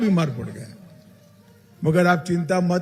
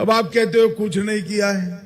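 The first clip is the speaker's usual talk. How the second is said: ordinary talk, nothing marked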